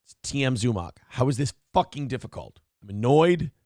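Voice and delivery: ordinary talk, nothing marked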